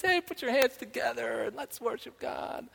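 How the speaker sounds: high voice imitating Sandy, upbeat. Pastor imitates wife calling church to worship, high upbeat voice. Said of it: "And Sandy says".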